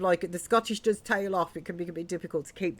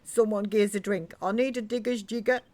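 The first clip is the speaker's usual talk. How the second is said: louder, higher acted grumble; indignant British miner; 5 semitones higher, 7 dB louder than usual. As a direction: protest like a fed-up miner wanting a drink. Game character complains and demands drink.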